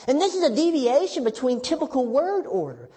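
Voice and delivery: usual speaking voice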